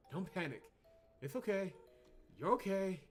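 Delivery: high, loud attempt at calming; frantic reassurance. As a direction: urge calm while clearly alarmed. Urging calm in a panic.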